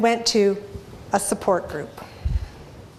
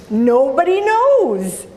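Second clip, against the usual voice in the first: ordinary talk, nothing marked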